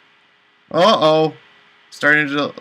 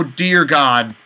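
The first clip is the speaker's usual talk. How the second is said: ordinary talk, nothing marked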